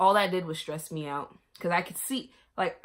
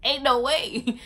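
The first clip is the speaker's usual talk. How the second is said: laughing; 6 semitones higher, 9 dB louder than usual. Real, not acted. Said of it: "I'm about to cry again".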